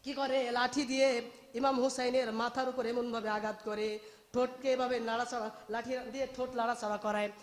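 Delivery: ordinary talk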